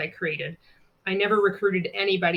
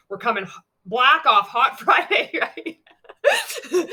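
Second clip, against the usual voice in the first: 1 laughing at slip; 5 semitones higher, 7 dB louder, slower than usual. Real, not acted. Laughs at her own slip.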